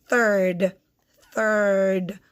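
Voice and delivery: "third" with a drawn-out "er"; sounded out. Shown as a demonstration. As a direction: stretch third as one syllable, "therrrd". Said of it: "only one syllable".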